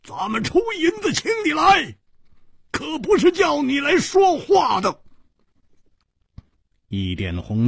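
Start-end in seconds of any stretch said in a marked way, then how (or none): 0.0-5.0 very high, shouted complaint; stamping indignation. A narrator's reading. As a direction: scold the hired swordsman in a high yell. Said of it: "跺着脚说". (跺脚气急地高喊)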